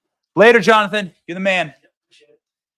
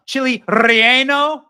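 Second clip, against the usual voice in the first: drawn-out, louder fake Spanish accent with rolled r; mock Spanish accent; 3 semitones higher, 5 dB louder than usual. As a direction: imitate a mother-in-law overdoing a Spanish accent. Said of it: "she starts speaking in a Chinese accent".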